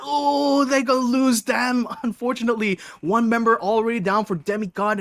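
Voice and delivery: high, loud shout that gradually settles; excited play-by-play. Real, not acted. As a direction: burst out excitedly, then ease down in pitch and volume. Live call of a sudden kill.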